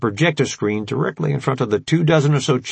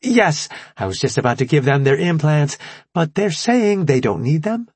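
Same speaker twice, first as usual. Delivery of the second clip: put-on perky young scientist; 6 semitones higher than usual. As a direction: sweetly introduce yourself. Scientist to general.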